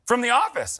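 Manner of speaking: high, loud imitation of a fan hailing a star; mock starstruck greeting; excited fan. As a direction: call out like a thrilled fan spotting someone. Fan hails him in street.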